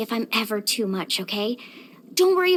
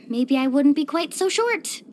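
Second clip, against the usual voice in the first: ordinary delivery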